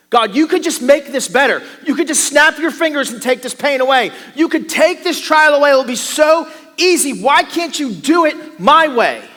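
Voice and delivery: loud, high pleading at God; demanding prayer. Acted. Preacher voicing a demanding prayer to God, loud and pleading. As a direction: insist to God, pushing hard.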